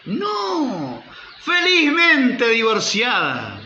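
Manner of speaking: high, drawn-out exclamation imitating a happy divorcee; exaggerated high-pitched delight, imitating; gleeful mock correction. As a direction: crow it proudly, stretching each word. Acts out woman's gleeful correction.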